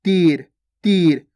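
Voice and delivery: long drawn ee in तीर, falling at the end; stretched long ee. Shown as a demonstration. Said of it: "थोड़ा खींच के उच्चारण कर रहे हैं". (तीर में ई खींचकर)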